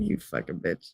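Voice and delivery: low, soft mock-gruff grumble; mock gruff insult. Partner playfully insulting her, low soft mock-gruff grumble.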